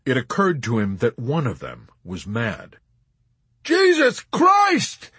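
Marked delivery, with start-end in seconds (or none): 3.6-5.1 high, drawn-out scream of tortured amazement; amazed scream. A narrator's reading. Yossarian aghast at his navigator lighting a pipe, amazed scream. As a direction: scream it in disbelief. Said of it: "he screamed at Arfi in tortured amazement".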